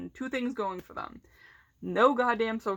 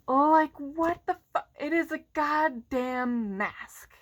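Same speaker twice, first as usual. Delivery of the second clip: exasperated disbelief; 5 semitones higher, slower than usual. Real, not acted. Exasperated rant about mask complainers.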